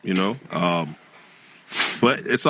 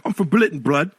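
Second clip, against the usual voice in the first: raised, put-on British street accent; 6 semitones higher than usual.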